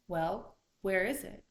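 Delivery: loud, impatient question; demanding bear. A narrator's reading. Bear to returning Water Spider, loud impatient demand. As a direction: challenge her loudly.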